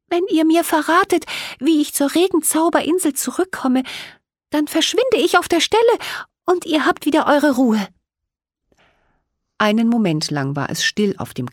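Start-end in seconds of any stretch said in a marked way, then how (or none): 0.1-7.9 much higher, light fairy voice, hopeful; a small fairy explaining her trouble and asking for help; little fairy Millie. A narrator's reading. Fairy Millie pleads with the two girls for help.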